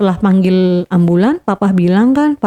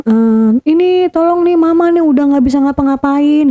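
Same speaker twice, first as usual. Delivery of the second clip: mock hysterical plea; 6 semitones higher than usual. Mother phoning father for help, mildly imitated hysterics. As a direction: mimic someone putting on a panicked call for help. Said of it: "terus dia teriak-teriak".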